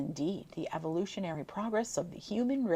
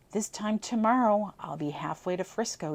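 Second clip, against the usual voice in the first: ordinary delivery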